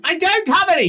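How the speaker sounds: high, loud grumpy bargeman; Lucas voice. Acted. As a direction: whine it out as a put-upon captain. Lucas protests.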